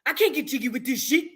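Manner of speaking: comic imitation of an African accent; mock African accent. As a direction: put on a joking African accent. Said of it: "Accent sounding like Coming to America".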